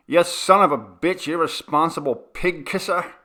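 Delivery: loud, high furious shout; raging landlord yelling at tenant. Acted. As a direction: scream the insult in his face. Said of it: "the man shouted".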